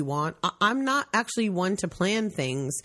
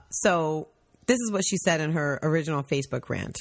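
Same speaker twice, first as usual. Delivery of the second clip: normal talking voice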